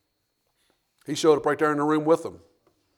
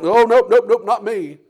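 louder, higher rapid nopes; panicky denial; 7 semitones higher, 8 dB louder than usual. Acted. Peter denying a second time to the accuser, loud and hurried. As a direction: shake it off in a hurry.

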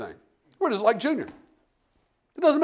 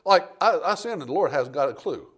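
ordinary talk, nothing marked

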